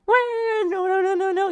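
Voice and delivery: very high, frantic no-no-no; panicked squeal. Retold or imitated. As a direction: shriek like a coward who was all talk. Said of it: "He starts freaking out, panicking".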